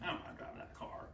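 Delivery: hushed Southern macho refusal; very quiet, stubborn Southern accent. Retold or imitated. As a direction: imitate a Southern husband muttering softly. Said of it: "he had that southern accent too".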